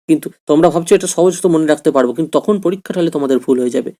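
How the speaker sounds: ordinary talk, nothing marked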